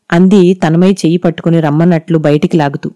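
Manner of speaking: plain reading voice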